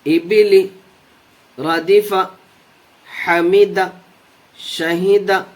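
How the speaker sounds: each word said whole, crisp and even; fluent word review. Shown as a demonstration. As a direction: read each word at once without spelling it out. Fluent review of drilled words.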